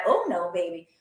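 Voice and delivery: normal talking voice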